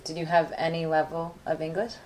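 usual speaking voice

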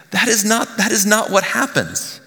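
high-pitched insistent correction; emphatic protest. Real, not acted. Preacher correcting what the congregation expects, high emphatic protest. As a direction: push the pitch right up to protest.

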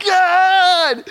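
high, loud cry of God; laughing shout. Retold or imitated. Man overcome by laughter calling out to God, loud. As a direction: call out, laughing uncontrollably. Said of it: "And I'm like".

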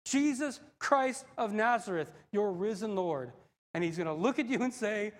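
ordinary talk, nothing marked

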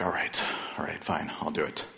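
reluctant, whispered giving in; grudging whisper. Retold or imitated. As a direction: mutter the surrender under your breath. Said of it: "I'm like".

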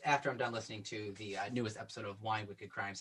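normal talking voice

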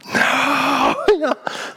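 high whimpering mock cry. Preacher pretending to wail at giving up his seat. Inner reaction before agreeing.

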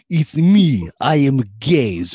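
playful stab at a Russian accent; attempted Russian accent. Shown as a demonstration. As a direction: deliver it in a deliberate Russian accent. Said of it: "Do you wanna give it a try?".

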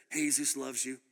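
low, halting "Jesus" as Spanish hay-SOOS; attempted Spanish. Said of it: "I wish I could speak Spanish".

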